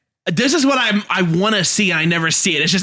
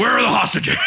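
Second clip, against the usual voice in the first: raised gruff hero shout; Batman voice; 7 semitones higher than usual. Retold or imitated. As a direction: bark it out like Batman. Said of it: "he's auditioning for Batman".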